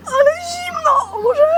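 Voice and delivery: slow, high laughing wail; cold shriek. Real, not acted. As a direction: wail at the icy water. Doused again, laughing shriek. (piskliwy krzyk z zimna)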